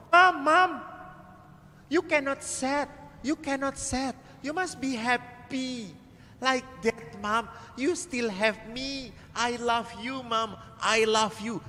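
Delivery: put-on high, childlike pleading voice; child's voice. Priest voicing the child comforting his crying mother, high and tender.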